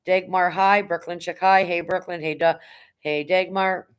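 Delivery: usual speaking voice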